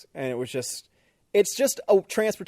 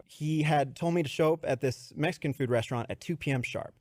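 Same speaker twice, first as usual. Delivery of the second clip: normal talking voice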